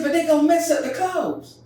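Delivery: high, loud worried mother's plea; mama fretting. Retold or imitated. Mama fretting to daddy about the clothes, high and loud. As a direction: fuss like a mother about her kids' clothes. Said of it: "She said".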